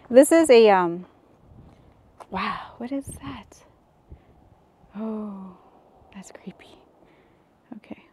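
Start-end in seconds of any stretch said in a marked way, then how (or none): 2.3-8.0 soft unvoiced whisper, a touch spooked; creeped-out whisper. Real, not acted. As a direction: whisper as if something might hear. Creeped out exploring a cave.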